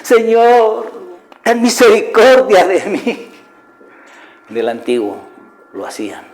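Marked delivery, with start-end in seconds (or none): 0.0-3.3 high, drawn-out mock wailing plea; imitated crying. Believer begging God for mercy, imitated crying. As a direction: act out begging through tears, pitched well up. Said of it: "yo lo dije muchas veces llorando".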